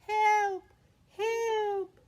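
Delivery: high frightened call; cry for help. Acted. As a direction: call out from the monster's cave. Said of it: "heard a voice crying".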